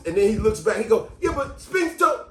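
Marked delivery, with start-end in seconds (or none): 1.2-2.3 high, drawn-out excuse; protesting student. Acted. Scolded student blames his classmate to the teacher, protesting. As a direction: plead your case and pass the blame.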